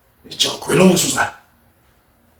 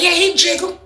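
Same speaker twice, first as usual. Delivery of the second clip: pitched-up mock tattling; high teasing voice. [name] mocking Lawrence to everybody, high teasing voice. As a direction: tease it up high.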